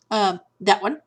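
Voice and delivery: ordinary delivery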